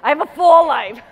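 loud, high mock announcement of a full life; over-the-top declaration, tongue in cheek; mock proud shout. Mock trying new phrase.

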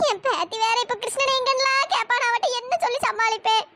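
ordinary delivery

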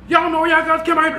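high mock yell, taunting; imitating a hyped yell. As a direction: quote the taunting guy yelling. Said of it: "like, yelling".

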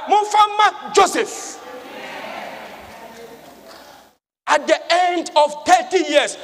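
high, loud, triumphant preaching; building, forceful sermon delivery. Acted. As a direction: proclaim it at full force from the pulpit.

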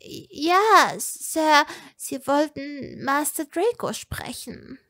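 high, nervous little servant voice; uneasy servant. A narrator's reading. Servant Egolina warning her master, high and uneasy. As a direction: squeak it out uneasily.

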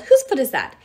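loud, irritated demand; yell. A narrator's reading. Nyx jostled in the dark, irritated yell. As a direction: yell the question crossly. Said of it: "Nyx yelled".

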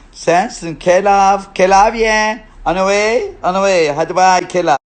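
high, loud comeback; loud retort. Acted. Hurling the name back at a commenter before dismissing them, loud retort. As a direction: throw the insult back at full volume.

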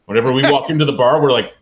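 ordinary delivery

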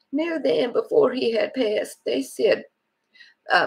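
ordinary talk

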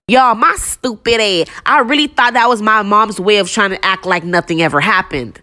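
normal talking voice

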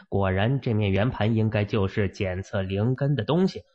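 ordinary delivery

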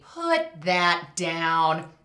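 slow, loud warning; stern mom. Retold or imitated. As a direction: drag it out as a firm command. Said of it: "Or there's the".

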